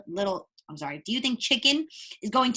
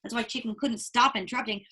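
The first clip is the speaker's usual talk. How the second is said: ordinary delivery, nothing marked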